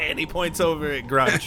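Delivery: ordinary talk, nothing marked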